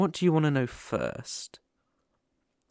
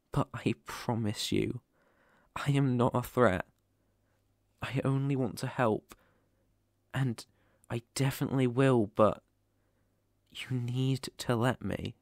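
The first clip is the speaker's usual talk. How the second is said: ordinary delivery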